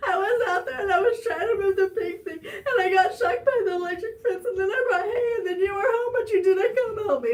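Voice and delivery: high, wailing mock cry; loud, high-pitched sobby whine; tearful outburst. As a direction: re-enact blubbering out a complaint. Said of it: "tears just started coming down my face".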